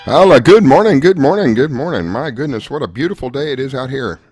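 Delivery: ordinary talk, nothing marked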